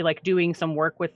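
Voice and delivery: normal talking voice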